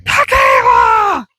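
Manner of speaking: long drawn-out high shout of the dog's name; hollering across the yard for the dog; calling out loud. Retold or imitated. Yelling the dog's name across the property. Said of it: "I don't wanna be like".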